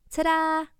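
high, loud ta-da reveal; excited yell. Acted. Girl unveiling basement present, shouting ta-da.

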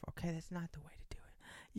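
confiding whisper, half to himself; whispered aside. Real, not acted. Host warning dieting listeners, whispered aside.

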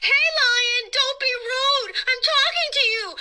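high, loud, indignant scream; screaming boy. Acted. Boy screams at the ignoring lion, high and loud. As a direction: yell at the lion to pay attention. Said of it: "the boy screamed".

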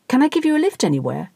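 fast, high and nervous; nervously. Acted. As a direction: rush the offer anxiously. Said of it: "asked Sir Kieran nervously".

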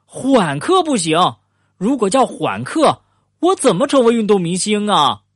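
high-pitched objection; loud protest. A narrator's reading. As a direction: protest aloud, pitch well up throughout. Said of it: "富兰克林大声说".

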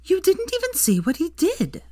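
sharp and high, clearly irritated; snapping. A narrator's reading. Hermione snapping at Draco over the student. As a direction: snap back at him. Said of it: "snapped Hermione".

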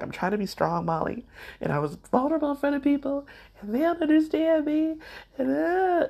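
hammed-up high-pitched lament of someone misunderstood; mock whining complainer. Imagined listener complaining to Molly about being vulnerable, mock whining. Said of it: "I don't want you to be like".

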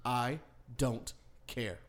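slow, flat "I don't care"; the dog lady brushing off the angry wife, slow and dismissive. Retold or imitated.

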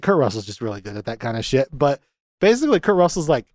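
normal talking voice

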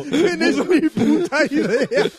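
high, slow words through laughter; squeezed out between laughs; laughing. Real, not acted. Laughing at the blunder.

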